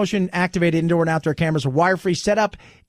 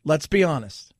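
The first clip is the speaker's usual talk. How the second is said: ordinary delivery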